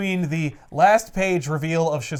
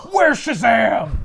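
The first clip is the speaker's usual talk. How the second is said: loud, raised, drawn-out mock interrogation; angry demand; 6 semitones higher, 6 dB louder, much slower than usual. Batman slams the robot against a wall, loud demand. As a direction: play Batman roughing someone up for answers.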